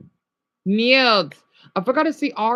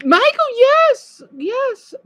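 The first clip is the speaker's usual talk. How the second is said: high, loud burst of joy; delighted yelp of recognition; excited shout; 6 dB louder, much slower than usual. Real, not acted. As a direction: cheer the viewer's name. Excited greeting to chat viewer.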